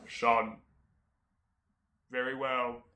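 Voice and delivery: lightly voiced, measured father; solemn father. Father consenting to son's plea, mock solemn. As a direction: grant it gravely, with long pauses.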